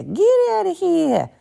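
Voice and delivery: slow, rising into a high, loud squeal of disbelief; stunned disbelief. Retold or imitated. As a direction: drag it out then shriek it. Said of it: "I was like".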